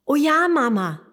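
high, loud eager call. Acted. Little dragon calling out proudly to his mum. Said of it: "ruft Drani".

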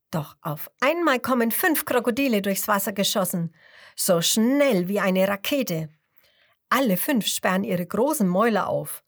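ordinary delivery

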